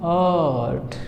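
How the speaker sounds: आर्ट as a long "aaat"; stretched long vowel. Shown as a demonstration. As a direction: hold the opening vowel of आर्ट. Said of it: "थोड़ा सा ज़्यादा खींचेगा".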